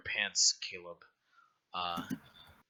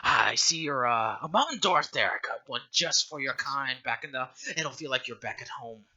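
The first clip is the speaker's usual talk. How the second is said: put-on higher old woman, hospitable; welcoming old matron; 5 semitones higher, 6 dB louder than usual. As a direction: usher the guests in for tea. Matron invites them in.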